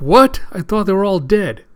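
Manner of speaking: high, loud acted "what"; shocked outburst. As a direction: exclaim in disbelief. Shocked at the revelation.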